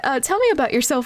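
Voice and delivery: usual speaking voice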